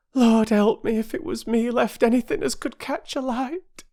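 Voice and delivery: high, fretful barge woman's voice; distraught mother. A narrator's reading. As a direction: fret and blame yourself. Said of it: "said the woman again and again".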